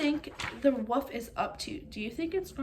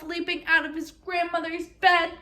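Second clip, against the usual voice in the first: ordinary delivery, nothing marked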